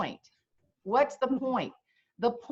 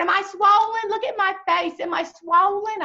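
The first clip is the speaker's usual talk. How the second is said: higher, louder, fretful asking; anxious Yankee; 10 semitones higher, 7 dB louder than usual. Retold or imitated. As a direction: worry aloud about a swollen face. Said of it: "Then she said".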